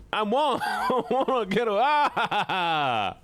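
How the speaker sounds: laughing through the words; real laughter. Real, not acted. Laughing while stuck on horse.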